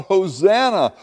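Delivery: high, loud acclaim; crowd calling out. Acted. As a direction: call it out like a hopeful crowd. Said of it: "They called out".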